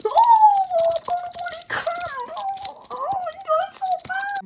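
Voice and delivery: very high, hammed-up moaning; squeaky wailing in pain. Wife complains to husband of cramp, squeaky wailing. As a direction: squeal as if badly faking agony.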